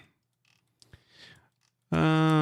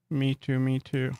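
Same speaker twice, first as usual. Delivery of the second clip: ordinary delivery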